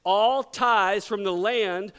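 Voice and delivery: ordinary talk, nothing marked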